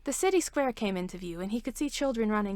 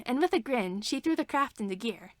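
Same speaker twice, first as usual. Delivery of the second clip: plain reading voice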